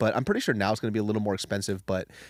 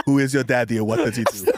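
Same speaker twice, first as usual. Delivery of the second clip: sing-song movie line impression; imitating a famous action star; Schwarzenegger impression; 4 dB louder than usual. Quoting Kindergarten Cop movie.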